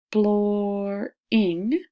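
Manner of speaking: Exploring stretched slowly, beat per syllable; slow sounded-out Exploring. Shown as a demonstration. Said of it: "listened for the syllables".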